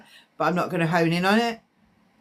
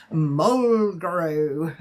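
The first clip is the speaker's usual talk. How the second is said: lightly witchy, drawn-out word; a bit witchy; 3 semitones lower than usual. Shown as a demonstration. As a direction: stretch it out with a hint of witch. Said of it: "Like a bit witchy".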